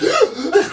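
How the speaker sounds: sudden sharp intake of breath; big noisy inhale; loud gasp. Retold or imitated. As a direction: imitate a loud gasp breaking the silence. Imitating the sudden noise after silence.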